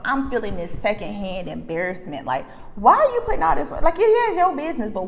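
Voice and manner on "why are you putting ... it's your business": high, loud, quick objection; exasperated protest. Retold or imitated. Onlooker objecting to public confession, exasperated high outburst. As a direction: burst out high in disbelief.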